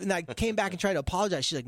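usual speaking voice

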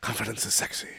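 low, hushed seductive murmur; soft ad-pitch purr, mock sultry; Jack Palance. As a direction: quietly sell it like a creepy heartthrob. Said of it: "So he's like".

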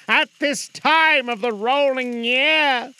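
high, slow, loud spectral lament; slow mournful ghost. Acted. Marley's ghost mourning to Scrooge. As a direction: stretch it out like a suffering spirit.

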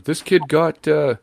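high, loud excited call; old man calling his wife over, excited. Retold or imitated. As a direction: shout the news across to his wife.

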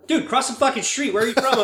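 high-pitched incredulous urging, not shouted; mock a driver baffled by a hesitant pedestrian; exasperated bafflement. Imitates baffled driver urging him across.

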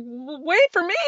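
high, pleading call to be waited for; mock-helpless wait for me; plea to wait. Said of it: "and I'm like".

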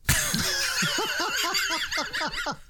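long high-pitched burst of laughter; real incredulous laughter; big high laugh. Real, not acted. Laughing at the answer.

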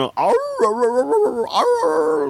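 imitating dogs howling. Cohost imitates his dogs waking him, mock howl. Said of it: "that's why they're howling".

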